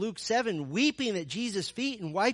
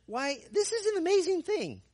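much higher, loud, puzzled mock wonder; mock bafflement; 13 semitones higher, 4 dB louder than usual. Healed man mocking the Pharisees, a high puzzled mock wonder. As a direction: play dumb, feigning amazement. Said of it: "I picture the guy scratching his head".

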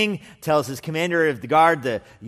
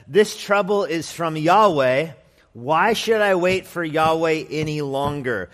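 loud, slow, higher complaint; bitter complaint; 3 semitones higher, 6 dB louder, slower than usual. Acted. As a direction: drag it out, loud and aggrieved. Messenger complains against Yahweh.

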